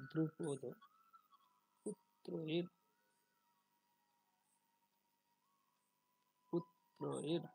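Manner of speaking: দ্রুপদ পুত্রয়েন loud, split into put-ro-yena; firm teacher's model of দ্রুপদ পুত্রয়েন; Sanskrit pronunciation. Shown as a demonstration. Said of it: "উচ্চারণটা কি হবে". (দ্রুপদ পুত্রয়েন জোরে ভেঙে সংস্কৃত উচ্চারণে)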